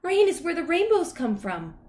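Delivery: high, loud, cheerful dog voice; high-pitched happy talking dog; Lucky the dog. A narrator's reading. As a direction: chirp it like a happy puppy. Cheerful dog talks of rainbows.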